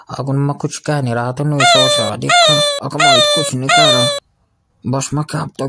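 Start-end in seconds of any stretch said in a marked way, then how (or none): 1.6-4.1 much higher and louder, exploding; angry outburst. Acted. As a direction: blow up at him. Bodyguard bursts out angrily.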